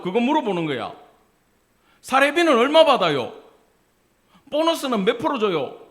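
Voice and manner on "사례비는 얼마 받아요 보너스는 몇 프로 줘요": high, loud mock interrogation; imitating a blunt questioner; loud nosy questioning. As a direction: fire it off as a prying question. Mocking nosy questions put to pastors.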